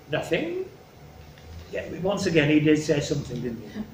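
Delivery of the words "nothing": soft "Nothing?" rising high at the end; questioning NOTHING. Acted. King echoes Cordelia's answer, "Nothing?" rising softly. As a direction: echo "Nothing?" quietly as a puzzled question. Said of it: "it was a kind of a question".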